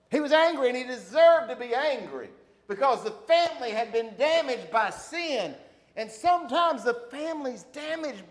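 loud, high preaching; full-force preaching. Real, not acted. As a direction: drive the point home from the pulpit.

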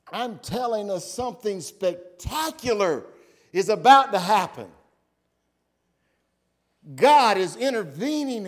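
loud, high-pitched proclamation; impassioned preaching. Real, not acted. Preacher announces God coming to bring salvation, impassioned. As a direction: preach it at full force, punching key words.

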